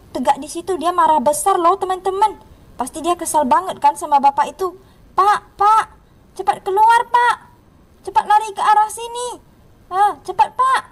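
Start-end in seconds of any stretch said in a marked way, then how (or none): 5.1-10.8 raised, higher-pitched urging; play-acted urgency; urgent calling out. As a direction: call to a man to get out fast. Urging man to flee ghost.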